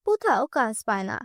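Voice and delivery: plain reading voice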